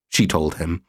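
ordinary delivery

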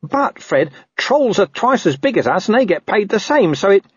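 high-pitched, anxious sidekick voice; squeaky underling trying to reason; placating corporal. Acted. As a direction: plead to calm the boss down. Narrator voices Nobby placating Colon.

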